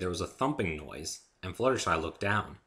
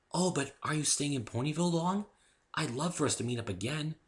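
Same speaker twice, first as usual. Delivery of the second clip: gentle Fluttershy voice; 8 semitones higher than usual. Acted. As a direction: speak sweetly and timidly.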